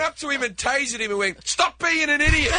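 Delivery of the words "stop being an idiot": barked police order, high and loud; mock cop shout. Australian cop shouting at the bomber, mock yell. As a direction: yell it like a cop ending a standoff.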